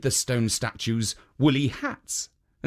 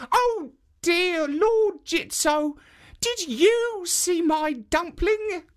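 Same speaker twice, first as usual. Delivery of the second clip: put-on high, slow little old woman; high slow granny voice; slower than usual. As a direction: pipe it up like a sweet old granny. Old woman asks third statue.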